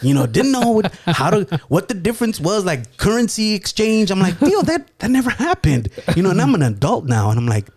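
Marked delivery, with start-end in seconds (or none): 0.0-4.1 high-pitched teasing retelling; mocking uncle. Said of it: "my uncle's like". 4.3-5.9 high, stammering imitated objection; exasperated protest. Grown nephew protests to uncle.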